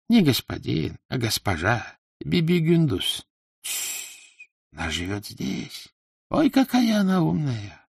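animated voice, hushing then marvelling at the mistress; confiding, wondering character voice; admiring slave trader. Acted. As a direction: speak of her with awe, pitch swooping up.

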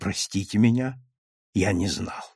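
soft, tender apology; gentle, kindly. A narrator's reading. Doctor apologises to the patient's daughter, gentle and kindly. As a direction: say it softly and kindly, letting it drop low at the end. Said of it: "доктор ласково сказал".

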